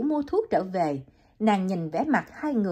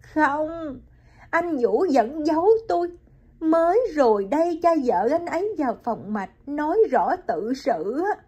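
high woman's voice, a touch of distress; Hiền reveals she learned from the father-in-law, high and hurt; young woman, hurt; 8 semitones higher than usual. Acted. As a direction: voice Hiền, higher and wounded. Hiền tells Liễu.

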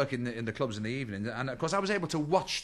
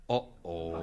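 low uh-oh; 9 semitones lower, 3 dB quieter than usual. Retold or imitated. Crowd reacts to breach of protocol.

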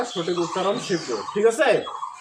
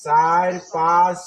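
counting syllables; 4 dB louder, much slower than usual. Shown as a demonstration. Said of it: "কেমন করে দেখো".

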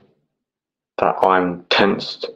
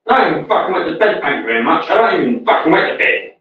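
indignant; 6 semitones higher, faster than usual. Real, not acted. Repeats denial, more worked up.

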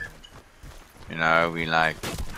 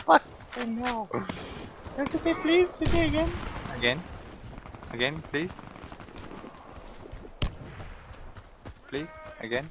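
high mocking voice; 7 dB quieter than usual. Taunting enemy in high voice.

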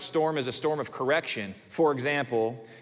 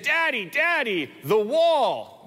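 put-on high, slow little girl calling Daddy; little daughter alarmed, calling her dad from the stairs; child's alarmed call; 8 semitones higher, 3 dB louder, slower than usual.

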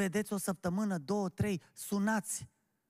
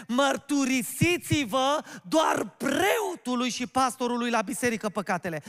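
loud, raised-pitch sermon exhortation; forceful preaching; 5 semitones higher, 5 dB louder than usual. Real, not acted. Preacher telling the congregation they have a duty, forceful preaching. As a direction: drive each duty home to the congregation.

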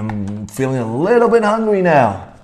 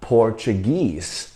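Portuguese, slow, with the last syllable stressed; stress at the end; 4 semitones lower, 4 dB quieter, much slower than usual. Shown as a demonstration. Teacher repeats Portuguese for the class, stress on the end. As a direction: lean on "guese" in Portuguese, slowly. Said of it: "重音在后面哦".

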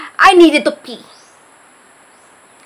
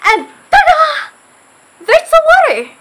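much higher, ta-da reveal; amazed; 13 semitones higher than usual. Real, not acted. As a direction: squeal it like magic. Amazed by the sensor faucet.

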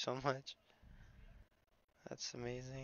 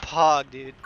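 excited shout; 9 dB louder than usual. Real, not acted. Hype at a kind donation.

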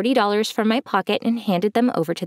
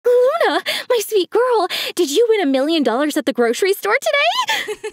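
high, squealing excitement; squealing mom; 11 semitones higher than usual. A narrator's reading. Mom squealing at her daughter about the win. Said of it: "My mom squealed".

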